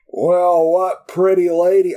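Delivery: high, loud mock Western cowboy; Western accent. Film fan imitating the cowboy teasing Peter, mock Western accent. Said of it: "Western accent is great".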